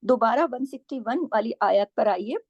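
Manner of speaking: normal talking voice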